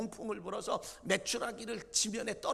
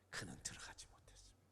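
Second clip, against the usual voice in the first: hushed, much lower whisper; 16 dB quieter, faster than usual. Acted. Preacher ending Moses' fate in a hushed whisper. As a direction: let it die away in grief. Sad climax of Moses' story.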